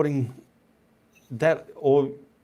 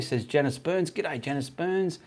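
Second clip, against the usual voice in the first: ordinary talk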